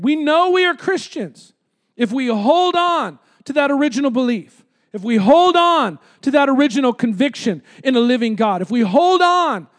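fervent preaching. Real, not acted. Preacher urging Christians to hold on to belief, fervent preaching. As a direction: lift and stretch each repeated phrase.

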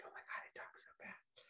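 barely voiced, hushed reaction; whispered aside. Listener reacting to a fast-talking podcaster, whispered aside. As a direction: whisper it as if to yourself.

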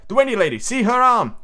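high, emphatic exclamation; excited cry. A narrator's reading. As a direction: cry out in wonder, pitched well up. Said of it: "he cried".

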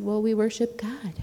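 light Indian accent imitating mom; a hint of an Indian mother. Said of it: "in her Indian accent".

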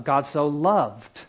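slow build to a swooping "loved"; stress on LOVED. Shown as a demonstration. Teacher telling listeners to emphasize love, stress on LOVED. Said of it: "emphasize love".

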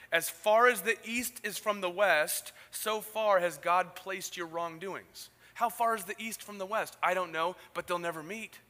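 loud, slow preaching. Acted. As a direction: proclaim it to the whole room. Preaching at full force.

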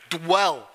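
"dwell" as one single syllable; mimicked correction, "dwell" in one beat. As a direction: run D straight into W in "dwell". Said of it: "It's one syllable".

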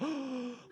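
high, sharp intake of breath; mock gasp of shock; imitated shocked gasp. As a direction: gasp as if horrified. Imitates everyone's shocked reaction.

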